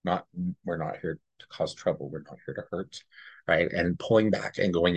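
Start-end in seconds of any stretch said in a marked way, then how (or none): 0.0-3.0 much softer, gentle and calming; soothing reassurance. Retold or imitated. Psychic reassuring a fearful spirit, soft and gentle. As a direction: ease its fear quietly.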